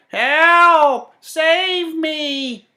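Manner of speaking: high, loud panicked cries; crying for help. A narrator's reading. The old woman calling from the flooded basement, high panicked cries. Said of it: "she cried".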